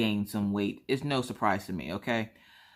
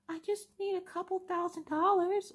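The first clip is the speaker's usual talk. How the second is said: put-on guilt-tripping kid; 4 dB quieter than usual. Daughter guilt-tripping into robbing bank.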